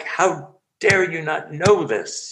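hammed-up how-dare-you, drawn out; mock indignant teacher. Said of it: "You know, like".

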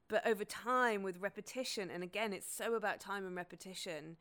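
normal talking voice

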